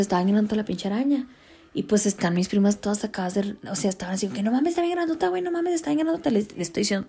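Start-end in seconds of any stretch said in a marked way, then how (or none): 4.4-6.3 amazed. Retold or imitated. Cousins amazed by spider.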